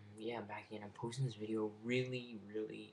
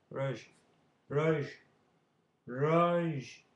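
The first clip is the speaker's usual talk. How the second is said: increasingly loud, higher calls in character; mock-insistent shouted name; calling out; 5 semitones higher, 11 dB louder, much slower than usual. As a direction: call the name out, building to a shout.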